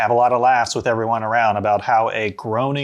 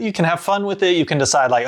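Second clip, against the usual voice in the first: ordinary talk, nothing marked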